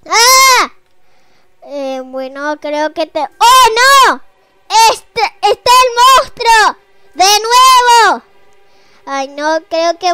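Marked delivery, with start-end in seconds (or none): 0.1-0.7 short high scream; startled cry of fright. Real, not acted. Monster appears in game. 3.2-8.2 high, loud scared cry. Real, not acted. Monster appears again.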